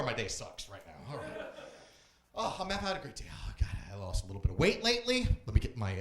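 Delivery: usual speaking voice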